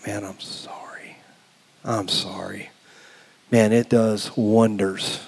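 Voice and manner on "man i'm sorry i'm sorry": very soft, near-whispered sorry; tender apology. Acted. Preacher modelling an apology to a spouse, hushed and sincere. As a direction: apologise low and humbly.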